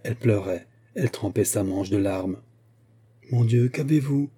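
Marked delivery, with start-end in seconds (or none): 3.3-4.3 higher-pitched, mildly alarmed question; distressed exclamation. A narrator's reading. Cousin startled by the girl's sobbing, upset exclamation. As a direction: cry out in concern, with restraint. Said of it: "s'écria Denise, bouleversée".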